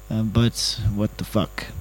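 ordinary talk

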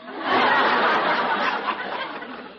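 rising wave of crowd laughter; audience laughter. Real, not acted.

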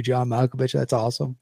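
ordinary talk, nothing marked

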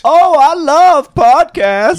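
high, loud, drawn-out delight; thrilled fan outburst; excited gushing. Acted. Gushing at hearing of podcast.

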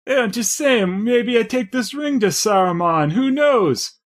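high sly scheming tone; mock scheming voice. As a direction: tempt them slyly. Acted scene response.

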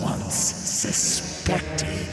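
slow rasping ghostly whisper; a sinister wraith savouring torment; cold hissing layered whisper. Acted. Thresh's voice.

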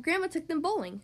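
usual speaking voice

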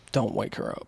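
low soft hush; hushed warning. Retold or imitated. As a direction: keep it down so mom sleeps. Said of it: "I would just be like".

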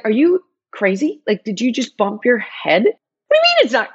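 high, exasperated questioning; retold incredulity. To partner mid-fight, incredulous and high. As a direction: question their sanity, pitch jumping up.

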